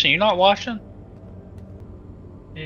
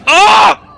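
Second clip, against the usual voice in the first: panicking gamer; 15 dB louder than usual. Real, not acted. Jump scare in horror game.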